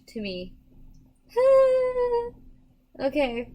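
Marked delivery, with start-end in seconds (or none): 1.3-2.3 high bright laugh; short giggle burst. Real, not acted. Laughs at cute grandmas.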